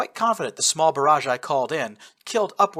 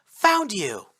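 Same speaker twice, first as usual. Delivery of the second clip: declared; 8 semitones higher, 4 dB louder than usual. A narrator's reading. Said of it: "Kotori declared".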